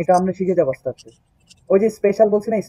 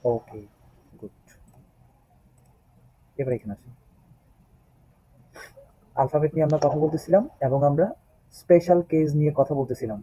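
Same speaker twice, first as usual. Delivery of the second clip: normal talking voice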